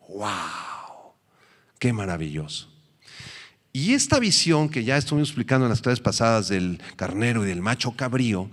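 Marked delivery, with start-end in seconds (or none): 0.1-2.7 hushed, wondering awe; marvelling softly at God's prophecy. Real, not acted. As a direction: breathe it in quiet wonder.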